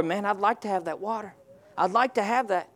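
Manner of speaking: soft, wistful wishing; a half-hearted wish; wishful mumble. Shown as a demonstration. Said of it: "I'm in that category".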